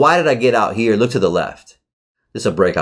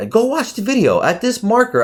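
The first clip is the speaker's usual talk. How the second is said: retold urging; 12 semitones higher than usual. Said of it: "I said".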